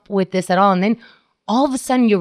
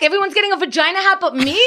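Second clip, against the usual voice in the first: mock outrage; 9 semitones higher, faster than usual. Real, not acted. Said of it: "What the fuck?".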